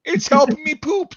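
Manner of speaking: very high, squeezed squeal; holding back laughter. Retold or imitated. Man in the stall trying not to laugh, squeaky. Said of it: "Trying not to fucking laugh".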